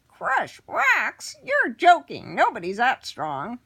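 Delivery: high, loud scoffing goblin; little goblin jeering; mocking goblin. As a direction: scoff at him as a tiny goblin. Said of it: "The goblin laughed".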